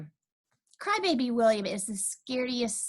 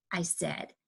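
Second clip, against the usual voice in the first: plain reading voice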